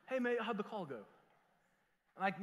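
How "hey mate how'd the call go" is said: put-on dubious English accent, could pass for Australian. Pastor redoes his English friend's call, imitated English accent. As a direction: repeat the wobbly English-accent phone greeting. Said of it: "Is that Australian or is that English?".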